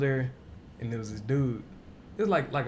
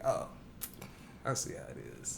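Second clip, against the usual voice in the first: deadpan; 7 semitones lower, 8 dB quieter than usual. Retold or imitated. Own retold deadpan reply.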